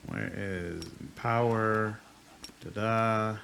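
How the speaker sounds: normal talking voice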